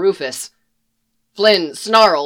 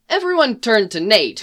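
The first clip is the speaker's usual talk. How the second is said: ordinary delivery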